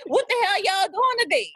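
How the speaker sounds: high, exasperated group-text call; mock exasperated. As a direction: demand what everyone's up to. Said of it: "text group up like".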